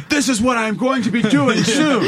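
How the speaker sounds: very high-pitched imitation of her announcing; teasing high-voiced mimicry; mock dictated outburst. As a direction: announce your action in a high, hammed-up voice. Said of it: "I love how you dictated everything".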